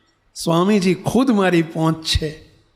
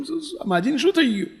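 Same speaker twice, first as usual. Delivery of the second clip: alarmed question; 8 semitones higher, 3 dB quieter, faster than usual. Retold or imitated. Household alarmed at old woman's cry.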